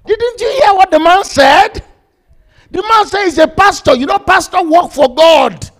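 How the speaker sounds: high, loud rebuke; acted gang leader shouting at his man; heated argument. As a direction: hammer it home, loud and high. Leader argues with his gunman.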